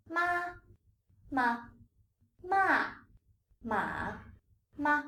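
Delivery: each "ma" separated by a pause, with its own tone; slow tone drill. Shown as a demonstration. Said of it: "I'm gonna read them slowly twice".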